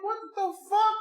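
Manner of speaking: very high-pitched incredulous question; mock disbelief. Retelling his shock at her finished beer, mock disbelief. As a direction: squeal it in comic astonishment. Retold shock at empty glass.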